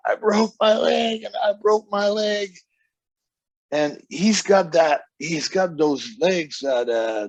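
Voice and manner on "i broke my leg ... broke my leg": high-pitched mock yell of pain; imitated yelling. Wrestler back in the dressing room claiming a broken leg, mock yelling. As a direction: cry out higher, as if hurt. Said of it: "and he's yelling".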